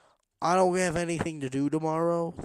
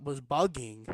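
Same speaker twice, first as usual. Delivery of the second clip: ordinary delivery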